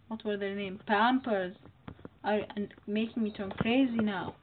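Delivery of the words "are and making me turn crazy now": ordinary talk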